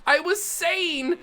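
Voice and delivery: high-pitched exasperation at yourself; exasperated whine. Real, not acted. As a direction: wail it in frustrated regret. Regrets ignoring own advice.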